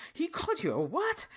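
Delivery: high-pitched, outraged disbelief, imitated; upset roommate. Upset roommate reacting to what she was called, high indignant disbelief. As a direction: act out an indignant friend's incredulous question. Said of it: "my roommate was so upset".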